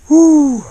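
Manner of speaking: sharp high excited hoot; loud high whoop. Real, not acted. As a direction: let out a loud high "ouh". Landing the fish.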